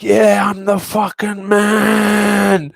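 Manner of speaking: high, slow, drawn-out gloating; cocky boast. Retold or imitated. Fighter after a win, mock-crowing with pride. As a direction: stretch it out like the king of the world. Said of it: "you're like".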